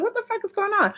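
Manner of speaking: high, soft, incredulous; stunned disbelief. Retold or imitated. Seeing the cousin dressed down, a high, hushed disbelief. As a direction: say it half to yourself, amazed.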